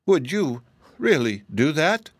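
high, mildly disappointed question. A narrator's reading. Hippogiraffe denied its meal of straw, disappointed. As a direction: ask it with a let-down lift in pitch. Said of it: "in a disappointed voice".

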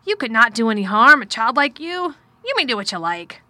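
loud, emphatic man's voice, rising; forceful reassurance, lightly worried; troubled guardian. A narrator's reading. Guardian reassuring a frightened child, loud and troubled. Narrator voices Mister Craven.

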